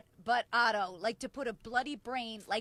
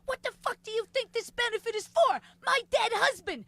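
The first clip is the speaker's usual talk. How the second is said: high-pitched indignant outburst, louder at the end; imitated angry yelling; 8 semitones higher, 4 dB louder than usual. Widow scolding comedian at the benefit, imitated angry yelling. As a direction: act out a widow telling off the comic. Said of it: "she's yelling at me".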